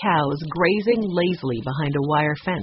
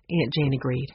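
plain reading voice

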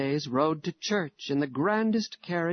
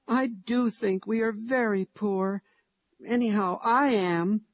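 put-on higher, eager child's voice; little boy; 7 semitones higher than usual. A narrator's reading. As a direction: read it as a small boy telling his mother. Narrator voices little Harry.

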